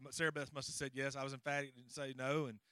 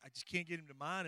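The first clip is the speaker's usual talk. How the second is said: ordinary talk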